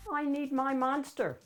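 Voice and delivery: plain reading voice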